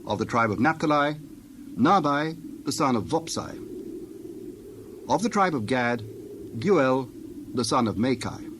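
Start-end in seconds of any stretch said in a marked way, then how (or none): none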